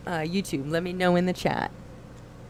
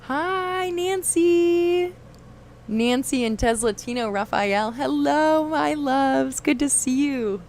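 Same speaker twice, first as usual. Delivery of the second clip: high, loud gushing hello; excited greeting; 8 semitones higher, 4 dB louder, slower than usual. Real, not acted. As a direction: greet them with delight. Greeting chatters.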